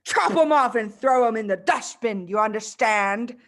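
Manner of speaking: loud headmistress bark. Acted. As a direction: bark the order at the cowering girl. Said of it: "she barked".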